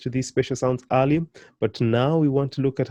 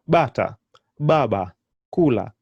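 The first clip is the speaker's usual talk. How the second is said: Bata, baba, kula each hit louder on the opening syllable; stress on first syllable; 4 dB louder than usual. Shown as a demonstration. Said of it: "the emphasis comes on the first syllable".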